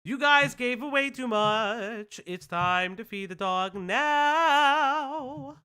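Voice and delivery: high, loud, drawn-out announcement; excited. Real, not acted. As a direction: sing it out to the chat, slow. Streamer's feed-the-dog call.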